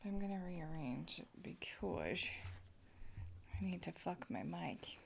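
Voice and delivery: unvoiced whisper aside; whispered aside near the mic. Real, not acted. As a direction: breathe it off-mic. Whispering while fixing mic.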